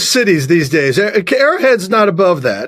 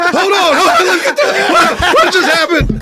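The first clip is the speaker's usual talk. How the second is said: high, laughing protest; mock bewilderment; 10 semitones higher than usual. Comedian stunned by the crowd's pile-on, high mock-bewildered protest. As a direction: protest in high-pitched disbelief.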